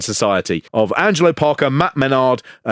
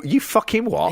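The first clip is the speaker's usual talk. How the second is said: incredulous Brit; 8 semitones higher than usual. Retold or imitated. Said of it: "we'll just go".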